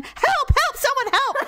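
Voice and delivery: high-pitched, comic panicked calling; mock frantic cry for help. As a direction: call for help like a helpless patient, hammed-up. Retelling patient frantically calling nurse.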